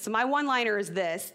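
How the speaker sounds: ordinary talk, nothing marked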